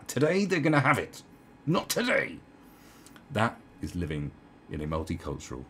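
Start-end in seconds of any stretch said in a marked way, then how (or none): 0.1-2.4 higher, louder indignant snap; hammed-up fed-up declaration; mock outburst. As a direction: act someone who has finally had enough. Acts out a passer-by snapping.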